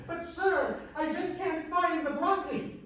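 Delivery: high, slow, drawn-out complaint; insistent little lady. Acted. Lady right in the stock boy's face, high and insistent. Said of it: "right in his face this time".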